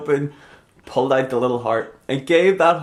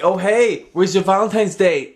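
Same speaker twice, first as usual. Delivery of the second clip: mock sarcastic taunt, pitched high; high, teasing sarcasm; 7 semitones higher, 4 dB louder than usual. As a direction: sneer it in fun. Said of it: "say it in a really sarcastic manner".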